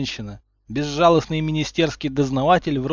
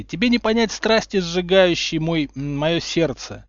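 slowing, lofty romantic declaration; passionate; 5 semitones higher, 5 dB louder than usual. A narrator's reading. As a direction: speak as a lovesick poet. Harry lovestruck. (страстно)

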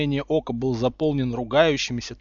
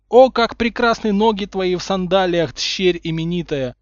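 rapturous declamation; 5 semitones higher, 5 dB louder than usual. A narrator's reading. Potter praising his lady's beauty, rapturous declamation. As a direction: declaim it like exalted love poetry. Potter's second mock love verse.

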